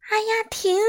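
high-pitched cry to stop; shouting. A narrator's reading. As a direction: yell as if your ears hurt. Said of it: "米歇尔捂着耳朵大声喊道".

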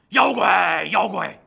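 loud shout into the water. Acted. Bajie yelling for the river monster to come out, loud shout. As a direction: bellow out the challenge. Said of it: "高声喊嚷".

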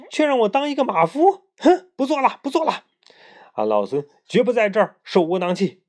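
怒气冲天; furious Monkey King. A narrator's reading. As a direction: storm out of the court in a rage. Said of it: "非常生气，火冒三丈".

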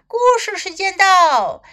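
high, loud and cheerful announcement; loud, jolly call. A narrator's reading. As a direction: call the class over with a jolly shout. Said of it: "很大声的、很欢乐的声音大叫道". (大声欢乐地喊叫)

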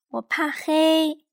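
high, slow, small cub voice; tiny cub afraid of the dark; Little Bear voice. A narrator's reading. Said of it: "小小熊说。".

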